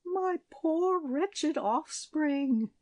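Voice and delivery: very slow, high wail; lamenting.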